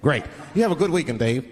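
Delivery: a hint of low, hearty business-speak; businessman voice. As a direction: sign off like a stock businessman. Voicing businessman on a deal call.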